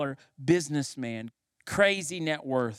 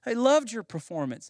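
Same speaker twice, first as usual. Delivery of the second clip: lightly acted stagehand, higher-pitched, urging; higher voice, astonished at the violinist's tears; a hint of an incredulous stagehand; puzzled stagehand; 6 semitones higher than usual. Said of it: "one of the stagehands says".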